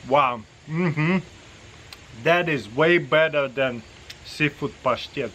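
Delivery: speaking between bites; chewing while talking. Real, not acted. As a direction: mouth partly full of food. Seen 2: Spoonful taken at -1; closed-lip chewing, talks around food after.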